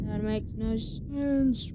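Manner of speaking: usual speaking voice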